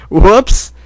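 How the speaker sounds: put-on high, giggly young boy voice; sheepish kid apologizing; boyish giggling colt. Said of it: "boyish giggle".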